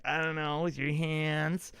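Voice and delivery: slow, drawn-out guess; clueless player's answer. Retold or imitated. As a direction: answer the coach unsurely, stretching it out. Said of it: "And he's like".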